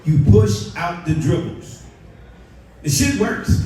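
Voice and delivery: normal talking voice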